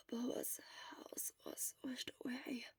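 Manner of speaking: weak sick whisper; barely voiced; hoarse whisper.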